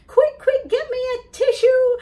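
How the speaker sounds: very high, loud hurry; urgent request. Acted. A child with dripping ice cream, high loud urgency. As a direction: demand a tissue fast. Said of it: "it's all dripping and".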